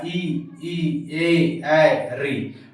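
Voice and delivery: drawn-out vowels ई, ई, ए, ऐ sung out one by one; teacher sounding ई, ए, ऐ for the class; front vowel demo. Shown as a demonstration. Teacher shows students front vowels ई and ए. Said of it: "अग्र स्वर का example ये हो जाएगा".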